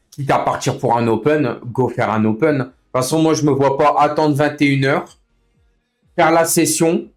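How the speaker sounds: normal talking voice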